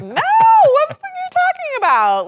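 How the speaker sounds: high, loud exasperated yell; exasperated shout. Retold or imitated. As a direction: blast it out, fed up and incredulous. Exasperated retort to staff.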